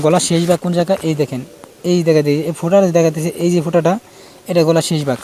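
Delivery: usual speaking voice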